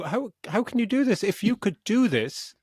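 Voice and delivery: high, incredulous exasperation; how could they, pleading with the screen; exasperated disbelief. Retold or imitated. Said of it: "I was just like".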